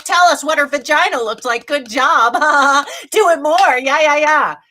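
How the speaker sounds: high, loud jeering imitation with laughter; mocking cheering-on. Imitating bosses who cheer the peeping worker on, loud mockery. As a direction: mockingly egg someone on, hammed-up and loud. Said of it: "They're like".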